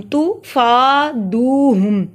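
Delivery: तुफादुहुम slowly, फा and दु held long; drawn-out recitation of तुफादुहुम; long vowels. Shown as a demonstration. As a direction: stretch फा and दु in तुफादुहुम. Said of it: "फा को इसलिए लंबा किया".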